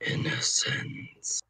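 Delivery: slow, breathy ghost whisper; ghostly whisper. Acted. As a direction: whisper it slowly like a spirit. Ghost speaks to the hunter.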